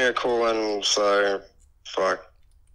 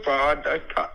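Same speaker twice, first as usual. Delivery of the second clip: ordinary talk, nothing marked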